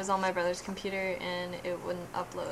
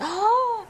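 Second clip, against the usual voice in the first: loud, high theatrical gasp; shocked gasp; 10 dB louder than usual. Retold or imitated. Fan reliving Moriarty's smile and gunshot, shocked gasp.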